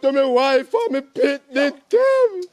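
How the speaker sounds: drawn-out, desperate prayer; slow pleading prayer. Acted. Stranded worker begging God to get him home, slow pleading.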